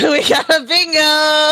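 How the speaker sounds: gleeful shout of victory; excited cheer. Real, not acted. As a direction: cheer it out, thrilled. Players cheering a bingo.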